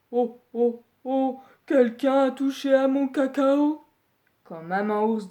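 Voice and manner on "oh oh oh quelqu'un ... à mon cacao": lightly voiced surprised bear father; a hint of a gruff papa bear crying out; Papa Bear exclaiming. Papa Bear discovering his cocoa touched, mildly acted. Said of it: "il s'écrie".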